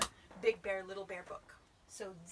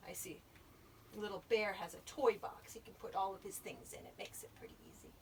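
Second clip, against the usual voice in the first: ordinary delivery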